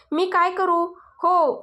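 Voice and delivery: ordinary delivery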